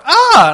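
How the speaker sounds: short high, loud yelp; wife's shriek. Retold or imitated. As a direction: yell out a startled "ah". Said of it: "she kind of yells".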